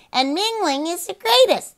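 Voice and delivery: high squawky parrot echo; laughing parrot. Acted. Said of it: "The parrot laughed into".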